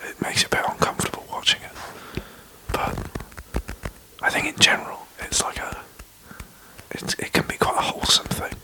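hushed podcast whisper, breathy and close. Real, not acted. Whispers all through podcast.

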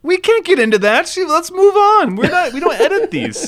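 high-pitched giggly deflection; squealing with laughter, way up high; laughing it off. Real, not acted. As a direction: laugh through the brush-off. Deflecting a joke on air.

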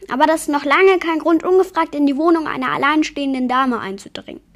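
a lady scolding an intruder; indignant. A narrator's reading. Yeti rebuking the vampire for barging in, indignant.

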